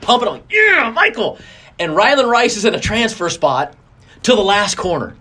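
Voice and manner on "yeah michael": high-pitched cheer for the winning son, pitch jumping way up; retold cheer. A dad cheering his son across the finish, high excited shout.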